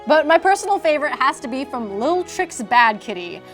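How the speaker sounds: ordinary talk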